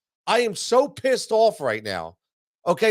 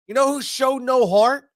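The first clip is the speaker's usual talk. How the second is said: angry shout; 7 semitones higher, 4 dB louder than usual. Real, not acted. Angry rant about the team.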